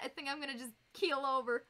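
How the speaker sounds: normal talking voice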